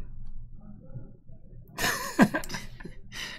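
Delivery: breathy high giggle; high laugh. Real, not acted. Laughing at own joke.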